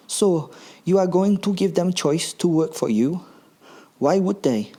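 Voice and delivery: put-on high, puzzled camp German accent. Gruber doubting that slaves will choose work, camp German accent. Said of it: "thick but very camp German accent".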